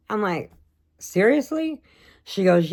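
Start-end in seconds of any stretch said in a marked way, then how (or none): 1.0-1.8 high, rising disbelief; squeaky seriously; incredulous. Retold or imitated. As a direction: pitch it up in disbelief. Incredulous reply in retold scene.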